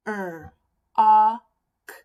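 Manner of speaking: "Er, ah, k" as rock split into held r, loud short o, k; "Er, ah, k" tapped out phonetically, sound by sound. Shown as a demonstration. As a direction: separate "Er, ah, k", punching the "ah" loudly. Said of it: "you can tap out the word".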